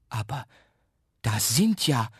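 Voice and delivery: hesitant start, then broken off; halting surprise. A narrator's reading. Mother spots the sweets, halting surprise. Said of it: "ruft sie und stockt".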